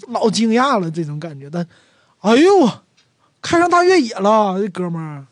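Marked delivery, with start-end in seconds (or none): 2.2-4.6 "哎呦" higher and louder, astonished; a sharp high "哎呦" at the classmate's SUV; right exclamation, surprised. Shown as a demonstration. Right-word demo: to an old classmate with an SUV, "哎呦" high and loud. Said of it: "这个比较通，比较正确的感叹词".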